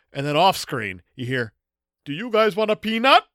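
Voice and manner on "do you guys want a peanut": Andre the Giant impression. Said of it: "That was your Andre impression".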